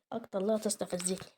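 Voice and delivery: normal talking voice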